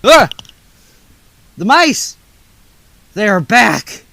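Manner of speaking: loud, high alarmed cry of fright; panicked shriek. Acted. Helper spotting the mice in the bakery, shrieking in fright.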